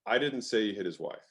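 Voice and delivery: I stressed, falling. Shown as a demonstration. Coach demoing stress on I to the host. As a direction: lean on the word I. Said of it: "a quick and fun example".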